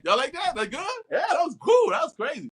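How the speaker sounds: high-pitched, bouncy eager questioning; acted eagerness. Actor checking with the crew after a take, acted eagerness. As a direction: imitate an upbeat actor seeking approval.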